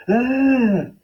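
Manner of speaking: sudden high scream of fright; high startled yell. Acted. Big man scared at the door, high startled scream. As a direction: yelp in terror. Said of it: "The man yelled".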